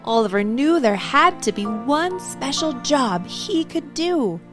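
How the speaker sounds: ordinary delivery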